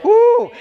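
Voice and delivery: high, loud "woo"; sudden exultant whoop; excited whoop. Real, not acted. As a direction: burst out in a delighted high whoop. Preacher whoops after his point.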